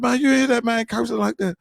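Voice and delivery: very high-pitched, squeaky disbelief; incredulous question. Acted. Asking deacon friend about the cursing man, incredulous squeal. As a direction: ask it way up high, scandalized.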